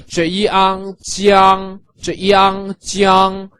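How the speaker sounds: jiang built slowly from its sounds; teaching blend of j-i-ang into jiang. Shown as a demonstration. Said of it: "So all together".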